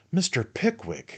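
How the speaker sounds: high astonished cry of a name; aghast outburst; astonished exclamation. A narrator's reading. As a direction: blurt the name in shock. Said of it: "exclaimed Mister Magnus, lost in astonishment".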